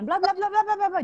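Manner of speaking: high-pitched run-on blabber; mock nagging chatter. Mother shows daughter how not to ramble, high mock chatter. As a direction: imitate babbling on without a break. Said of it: "jangan merepet".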